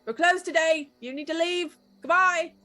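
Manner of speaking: a loud attempt at a gruff shopkeeper; gruff man yelling. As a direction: bark him off the door, loud. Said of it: "a deep, gruff voice".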